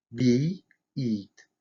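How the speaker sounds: "Би, ит" with a soft ee vowel; soft и in "Би" and "ит", each set apart. Shown as a demonstration. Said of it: "в основном мягкое". (мягкое «и» в словах «Би», «ит»)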